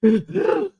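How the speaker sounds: exaggerated out-of-breath panting; mock winded gasping. Streamer imitating rivals failing the workout, mock gasping. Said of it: "They be like".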